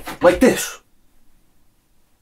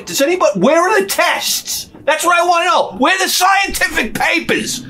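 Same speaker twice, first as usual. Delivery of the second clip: ranting shout; 9 semitones higher than usual. Acted. Comedian shouting at the crowd for smoking research. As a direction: yell it like a fed-up citizen. Ranting demand for scientific papers.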